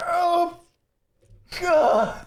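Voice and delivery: high, strained groan of pain; Ash. Acted. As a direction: cry out as if badly hurt, hammed-up. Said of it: "flat out is just in pain".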